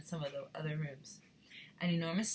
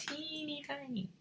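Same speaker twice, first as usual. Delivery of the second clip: tiny voice; 8 semitones higher, 7 dB quieter than usual. Real, not acted. Illustrating a tiny size.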